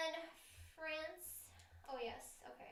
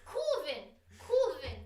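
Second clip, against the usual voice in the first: laughing; 9 dB louder, slower than usual. Pretend. Wordplay, louder with laughter.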